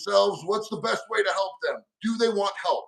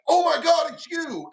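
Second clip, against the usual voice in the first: surprised fan; 7 semitones higher than usual. Retold or imitated. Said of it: "I heard this voice, like".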